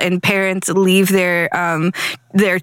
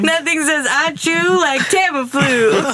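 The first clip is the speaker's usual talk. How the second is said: ad read voice; 5 semitones higher, slower than usual. Pretend. Mock commercial tagline.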